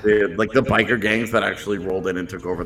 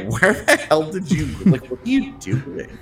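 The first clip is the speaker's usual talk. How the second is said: mock-baffled, pitched up, exasperated questioning; exasperated disbelief; 6 semitones higher than usual. Podcaster voicing a fan's reaction to Byram's play, exasperated disbelief. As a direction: act out incredulous exasperation at the play.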